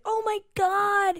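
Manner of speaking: high, drawn-out gasp of wonder; stretched, high retold marvelling; mock amazement. Men marvel at tattoo, retold.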